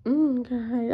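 ordinary delivery

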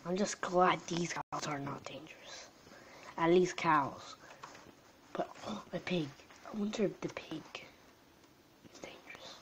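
soft steady whisper. Real, not acted. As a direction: whisper to keep the house asleep. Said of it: "I still gotta keep quiet".

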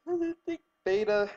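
ordinary delivery

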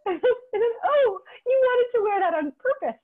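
high, laughing mock astonishment; laughing disbelief. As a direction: giggle through the quote in amused disbelief. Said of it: "I thought".

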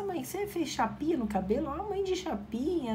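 an impressed son noticing his mother's hair; son's surprise. Retold or imitated. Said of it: "o Felipe chegou do trabalho e falou".

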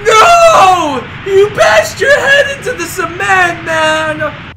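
high yelling in dismay; shocked yelling. Real, not acted. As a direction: yell as he crashes. Character crashes in game.

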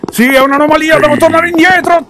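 very high, loud panicked yell; panicked shouting. Real, not acted. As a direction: yell it while fleeing. Panicked running back.

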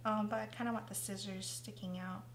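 ordinary talk, nothing marked

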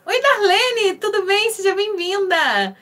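bright, high greeting for viewers arriving in the live; cheerful high welcome. Real, not acted. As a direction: greet each new viewer warmly and excitedly, pitched high.

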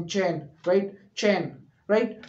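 ordinary talk, nothing marked

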